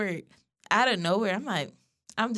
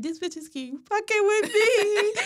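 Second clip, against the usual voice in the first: high complaining quote; 13 semitones higher, 3 dB louder than usual. Retold or imitated. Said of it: "call my best friend on the phone 'cause I'm like".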